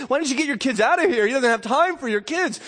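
mock-annoyed disciples hurrying people off; annoyed apostles. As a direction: shoo the parents away, irritated. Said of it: "the apostles are somewhat annoyed by this and say".

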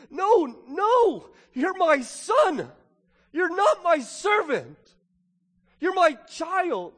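put-on a father near tears begging his boy to hear he's a son; tearful father's plea. Father to angry older son, tearful and pleading. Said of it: "tears in the father's eyes when he says".

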